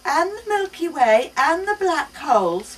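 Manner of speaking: slow, lightly voiced little girl listing; a hint of a child reciting; matter-of-fact child. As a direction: list the universe like plain facts. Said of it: "my daughter adds matter-of-factly".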